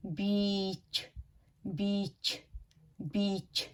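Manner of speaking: बीच with the vowel stretched like bee; long "bee" sound. Shown as a demonstration. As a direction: draw out the ee in बीच. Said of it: "ऐसा लगता है जैसे वो बी".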